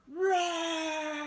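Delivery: put-on boy roaring as a tiger. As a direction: roar like a kid playing tiger. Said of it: "and roar".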